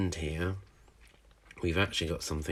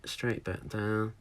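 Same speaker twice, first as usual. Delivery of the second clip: ordinary delivery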